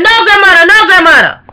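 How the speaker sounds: put-on a hollering little kid; child hollering. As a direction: cry out like a scared little kid. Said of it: "Little kid hollered".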